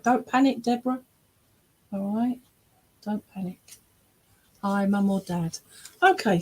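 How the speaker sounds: normal talking voice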